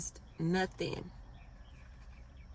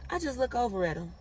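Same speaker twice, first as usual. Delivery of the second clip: ordinary talk, nothing marked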